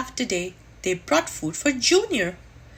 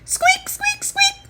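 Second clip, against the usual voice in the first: very high, thin squeaks; squeaking chick; 4 dB louder than usual. Acted. The baby squeaking in the egg as the birds wait, very high. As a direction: squeak like a tiny chick about to hatch.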